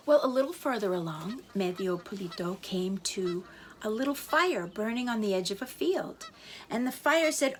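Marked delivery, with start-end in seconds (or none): none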